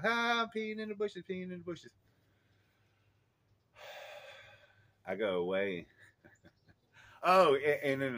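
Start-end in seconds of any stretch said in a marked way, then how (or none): 0.0-1.9 very high singsong child impression; imitated giddy kid, pitched way up; gleeful little boy. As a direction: crow it like a delighted small kid. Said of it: "He's laughing".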